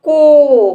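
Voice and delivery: को said loud and long with round o; loud ko demo. Shown as a demonstration. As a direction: model को clearly for kids. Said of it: "पढ़ेंगे इसको". (को को ज़ोर से साफ़ बोलना)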